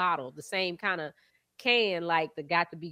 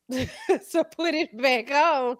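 laughing; 7 semitones higher, 8 dB louder, slower than usual. Real, not acted. Laughing at own glue story.

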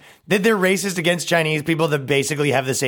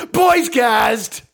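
hype shout; 6 semitones higher, 4 dB louder than usual. Real, not acted. Host hyping up the boys cast with a loud high call. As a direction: shout it out like a rallying cry. Calling out to his audience.

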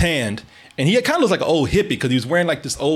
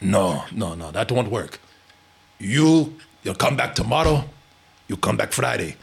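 broken Turkish English; 8 semitones lower than usual. Retold or imitated. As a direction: rule it out firmly in lightly mimicked Turkish English. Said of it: "he speaks broken English".

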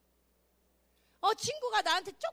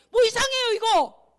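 loud, higher acted protest; blurted objection; 5 semitones higher, 8 dB louder than usual. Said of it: "가서 그 말이라도 해야지".

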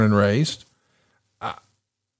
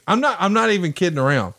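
usual speaking voice

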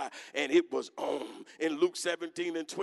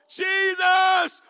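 much higher, much louder desperate cry of "Jesus"; lepers crying out; 14 semitones higher, 13 dB louder than usual. Acted. Lepers calling to Jesus from a distance, desperate shout. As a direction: scream his name across the crowd. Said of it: "they made a loud noise".

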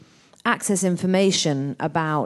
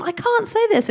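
high-pitched mock objection; comically appalled, pitched up; retold protest. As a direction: protest in a much higher voice.

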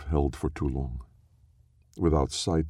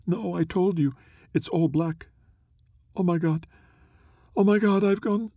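high, rising panic as she goes blind; a girl losing it, voice climbing; panicked girl; 4 dB louder than usual. Acted.

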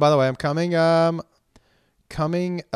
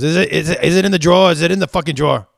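louder and higher, getting irritated; impatient yell; 5 semitones higher, 9 dB louder, faster than usual. Retold or imitated. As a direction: demand it with rising impatience. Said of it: "He goes".

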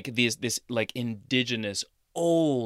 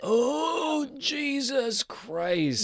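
high, slow, drawn-out shock; horrified gasp; 9 semitones higher, much slower than usual. Real, not acted. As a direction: recoil in real horror.

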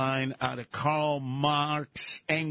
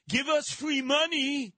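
high, slow smug banker; mock the banker, high and drawn out; 7 semitones higher than usual. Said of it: "you created this nonsensical term".

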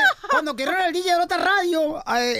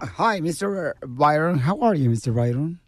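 English-speaking stranger; 10 semitones lower than usual. Pretend. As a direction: pose as a deep-voiced American stranger. Prankster plays English-speaking man. (voz muy grave y lenta de gringo fingido)

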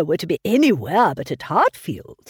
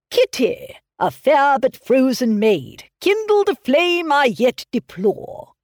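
slow, measured recital of a poem; reciting verse; 5 semitones higher, 4 dB louder, slower than usual. Acted. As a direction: savour each line of the riddle. Recites the old riddle verse.

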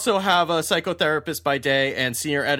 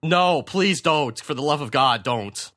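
slow, drawn-out, exasperated plea; pleading; 3 semitones higher, 4 dB louder, slower than usual. Real, not acted. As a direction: beg her to stop, slowly. Pleading with Georgia to stop.

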